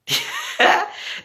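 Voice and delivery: delighted squeal of laughter at the chat; high burst of laughter. Real, not acted. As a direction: let out a shrieking giggle. Laughs at a fan comment.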